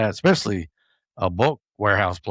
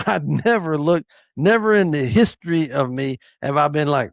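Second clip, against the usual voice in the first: usual speaking voice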